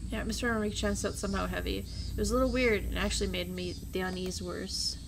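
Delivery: usual speaking voice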